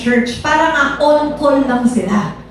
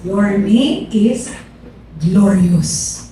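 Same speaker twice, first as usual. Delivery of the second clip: slow and lower, landing firmly on glorious; slow falling prophetic close; slow prophecy, falling; 6 semitones lower, much slower than usual. Retold or imitated. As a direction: deliver the name like a final revelation. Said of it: "Tapos sabi niya".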